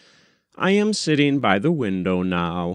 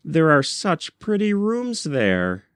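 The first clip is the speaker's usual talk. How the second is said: higher, coaxing plea to her husband; gentle pleading; 7 semitones higher than usual. Acted. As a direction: ask sweetly, hoping he gives in. Wife pleads with husband John.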